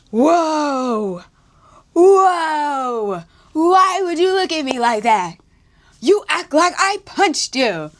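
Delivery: hammed-up offended date; exaggerated indignant impression of her; mocking the date. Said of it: "and she was like".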